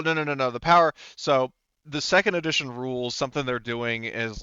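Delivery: normal talking voice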